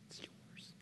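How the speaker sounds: barely audible whisper; whispered surrender. Retold or imitated. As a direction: let it go in a breath. His answer to God.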